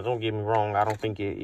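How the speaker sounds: ordinary talk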